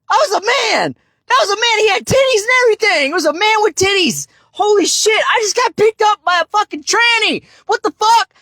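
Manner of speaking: high, fast, amped-up retelling; excited disbelief. Real, not acted. Man telling viewers his shock, high and fast. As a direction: still reeling, rattle it off.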